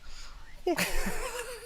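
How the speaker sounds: high breathy laugh breaking out; high unvoiced giggle; burst of laughter. Real, not acted. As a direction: laugh at the surprise. Laughing at the Rasta surprise.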